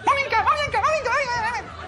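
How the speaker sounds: put-on very high, chirpy child voice calling mummy; excited little girl. Little girl happily calling her tired mother, very high and chirpy. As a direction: squeal for mummy like a happy toddler.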